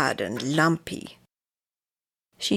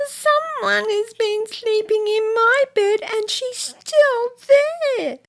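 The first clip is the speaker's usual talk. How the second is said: high squeaky little bear voice; tiny baby bear, pitched way up; bear cub; 4 dB louder than usual. Acted. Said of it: "said the little wee baby bear".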